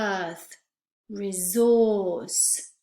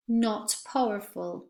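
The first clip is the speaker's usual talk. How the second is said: ordinary delivery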